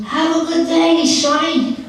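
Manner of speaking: a hint of a creaky granny calling out to a young man; old woman voice. Speaker voicing the roadside old woman questioning the traveler, lightly voiced old woman.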